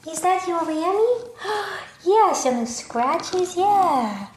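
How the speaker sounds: high, sing-song talk to a puppy. Real, not acted. Reassuring the puppy in his crate, high baby talk.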